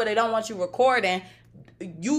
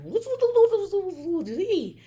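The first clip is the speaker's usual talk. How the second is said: mimicking a stranger; 10 semitones higher than usual. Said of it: "and he's like".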